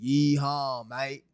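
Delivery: badly faked Southern cheer; mock-Southern twang gone astray; muddled Southern accent. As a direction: attempt a Southern accent and miss. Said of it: "I don't know what type of accent".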